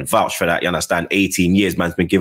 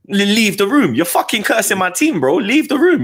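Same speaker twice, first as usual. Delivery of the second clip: much higher, worked-up re-enactment; retold heated order; 13 semitones higher than usual. Husband retelling a row over his team, high-pitched and exasperated.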